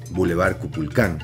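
ordinary delivery

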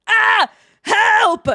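high, loud yell for rescue; mock scream for help. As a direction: shout for help, hammed up. Mock cry for help in game.

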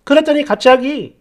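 usual speaking voice

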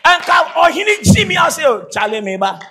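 loud, high shouted exclamation; acted outcry at full volume; calling out. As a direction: shout across to someone, pitched high.

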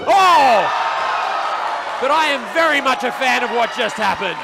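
loud, high thrilled call; excited. Real, not acted. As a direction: cheer it out loud. Commentator cheering a big move.

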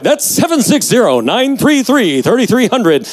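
high, pushy TV pitchman voice; infomercial. Pretend. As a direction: sell the number like a late night ad. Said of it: "I sound like an infomercial".

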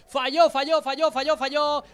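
rapid excited calls of missed shot; excited repeated call. Real, not acted. (gritando emocionado)

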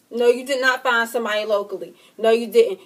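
loud, indignant refusal; angry denial. Real, not acted. Angry denial after being dumped.

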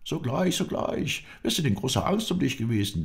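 high-pitched excited servant; breathless-with-relief greeting, pitched high; eager, flustered welcome. Acted. Doorkeeper eagerly greets returned friend.

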